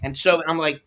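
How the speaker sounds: ordinary talk, nothing marked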